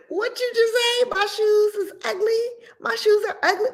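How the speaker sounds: very high outraged squeak; offended disbelief. Acted. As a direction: gasp at the insult in high pitch. Offended reaction to insult.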